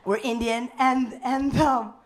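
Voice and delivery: ordinary talk, nothing marked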